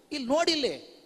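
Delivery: a hint of a pestering child; insistent child. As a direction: demand attention like a small child. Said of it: "ಕೈ ಹಿಡಿದು ಎಳೆದು ಎಳೆದು ಹೇಳುತ್ತೆ".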